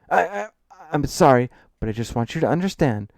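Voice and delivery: flustered, stammered apology, starting high; stammering. A narrator's reading. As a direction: trip on the first word, then push on. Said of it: "Adam stammers".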